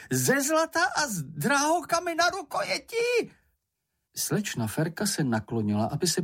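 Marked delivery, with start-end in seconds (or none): 0.1-3.3 very high squealing creature, thrilled; Čenich crying out. A narrator's reading. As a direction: squeal with delight at treasure. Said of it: "vykřikl". (nadšené pisklavé vykřiknutí)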